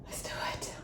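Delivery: nervous whisper before the reveal; whispered to herself. Real, not acted. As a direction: breathe it out, bracing yourself. About to check the test.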